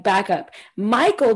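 ordinary delivery

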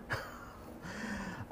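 soft airy laugh; a quiet breath of a laugh; real amused exhale; breathy laugh. Real, not acted. Laugh after quoted question.

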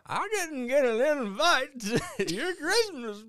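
slow, high, loud grizzled prospector; old prospector voice. Pretend. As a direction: ham up a crusty old gold miner. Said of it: "a two hundred-year-old prospector when you do an impression".

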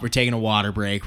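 normal talking voice

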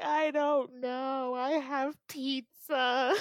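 slow, high mock-crying words; teary mumble. Dreamer answers her friend, slow and mock-teary. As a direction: say it slowly through fake tears. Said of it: "I was just like".

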